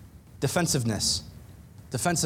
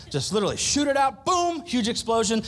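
excited burst; 6 semitones higher than usual. Real, not acted. Preaching about guys exploding.

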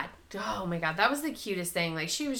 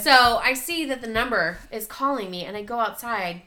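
ordinary talk, nothing marked

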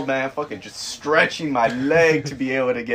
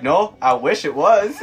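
1 laughing reply; 7 semitones higher, 6 dB louder, slower than usual. Retold or imitated. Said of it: "And I'm like".

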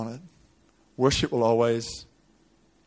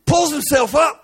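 high, raised preacher's cry; high-pitched dramatic sermon telling; impassioned preaching; 13 semitones higher, 7 dB louder, slower than usual. Acted. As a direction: preach the story at full pitch. Preaches the story at full force.

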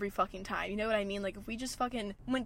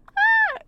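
squeal; 13 dB louder than usual. Real, not acted. Squeals back at her dad.

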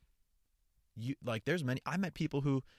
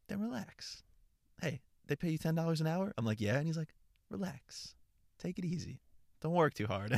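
laid-back coworker; 6 semitones higher than usual. Retold or imitated. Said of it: "not gonna try to replicate the accent".

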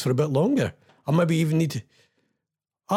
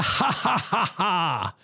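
mock laughing; 3 semitones higher, much slower than usual. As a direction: break into a playful cackle. Mock laugh at stalled game.

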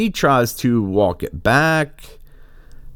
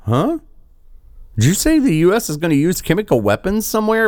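incredulous surprise; 8 semitones higher than usual. Retold or imitated. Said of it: "And everybody's like".

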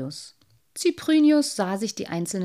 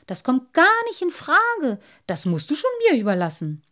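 loud, high indignant refusal, then fast; won't hear of throwing it away; 8 semitones higher, 8 dB louder than usual. Acted. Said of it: "brauste der kleine Wassermann da aber auf".

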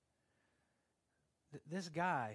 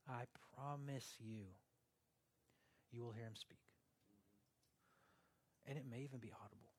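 soft whisper of a promise; 4 semitones lower, 10 dB quieter, faster than usual. Real, not acted. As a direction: breathe it as a promise. Intimate promise.

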